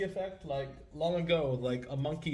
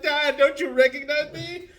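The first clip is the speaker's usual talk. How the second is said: a lightly voiced daughter, higher and louder; hurt daughter; 11 semitones higher, 9 dB louder than usual. Daughter pleading with the father who forgot her, mock hurt. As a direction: plead as a stung daughter, half in jest. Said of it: "she's like".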